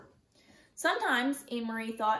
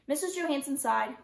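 plain reading voice

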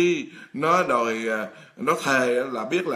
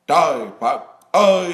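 exasperated outcry; 8 dB louder than usual. Acted. Exasperated exclamation in sermon.

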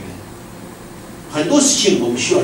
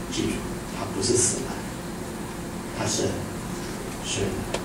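soft reassurance; 9 semitones lower, 14 dB quieter than usual. Acted. Jesus reassures, voiced softly.

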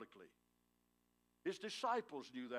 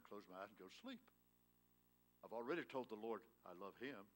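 usual speaking voice

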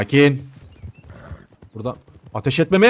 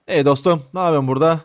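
normal talking voice

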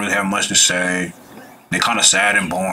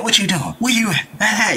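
high, eager rapid questions; overexcited; 12 semitones higher than usual. Retold or imitated. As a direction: pester her like a clingy guy. Voicing too excited man.

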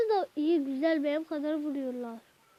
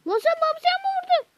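much higher, loud outraged yell; furious shout; 12 semitones higher, 7 dB louder than usual. Real, not acted. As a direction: scream it at the enemy who struck Bamsı. Yelling at enemy who hit Bamsı.

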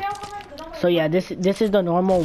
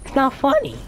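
voice cracking; 7 semitones higher than usual. Real, not acted. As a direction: voice cracks up high. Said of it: "That voice crack".

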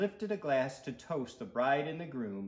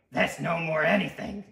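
loud, cocky character voice; sweet tooth voice; 4 semitones higher, 7 dB louder than usual. A narrator's reading. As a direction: talk back like a pushy little monster. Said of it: "said the tooth".

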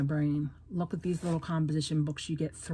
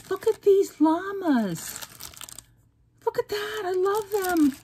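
much higher, slow and louder; delighted squeal; 12 semitones higher, 4 dB louder than usual. Real, not acted. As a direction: gush over the llamas. Thrilled by llama stickers.

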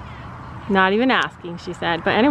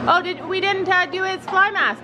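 normal talking voice

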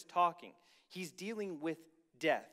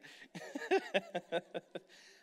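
chuckling run after a joke; amused laughing fit; real laughter; 3 semitones higher than usual. Real, not acted. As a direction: laugh it out freely. Preacher laughs at own joke.

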